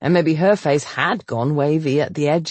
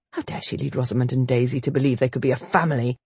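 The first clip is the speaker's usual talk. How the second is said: usual speaking voice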